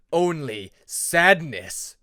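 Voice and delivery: very slow, loud threat; menacing dragon. Acted. Shadow dragon closing in on Peacemaker, slow and loud. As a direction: boom it out slowly.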